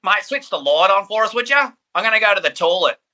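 put-on broad, high-pitched Aussie accent; exaggerated Aussie bloke voice; really Aussie person. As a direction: lay the Australian accent on thick. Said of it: "that would be a really Aussie person".

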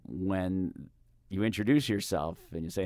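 ordinary delivery

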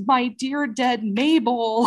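put-on milk a phony lament for a dead baby; mock crying. Husband faking grief next door, hammed-up slow sob. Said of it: "he starts crying and says".

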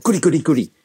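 quick, happy little chant; a touch of delight at the phone; mild glee. Shown as a demonstration. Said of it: "when the phone rings, think to yourself".

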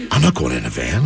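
very low, scared refusal; low paranoid wife. Retold or imitated. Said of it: "She's like".

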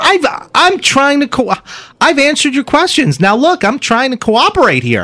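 high, stammering mock indignation; flustered car salesman. Salesman defending himself to the questioner, flustered and high. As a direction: insist you're cooperating, high and exasperated. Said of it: "acting out a scene from the film Fargo".

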